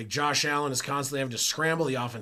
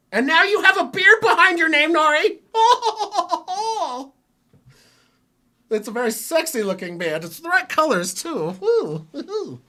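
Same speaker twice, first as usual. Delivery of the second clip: much higher and louder, laughing through it; gushing over a friend's new name; excited teasing; 10 dB louder than usual. Real, not acted. Joking to Nori about his new beard, high, loud and giggly.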